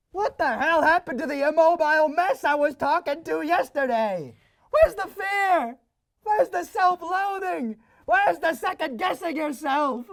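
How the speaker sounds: high, indignant demands; exasperated rant. Acted. Inner voice demands the anxious mess back, exasperated rant. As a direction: build a high-pitched, affronted tirade. Rants at vanished self-doubt.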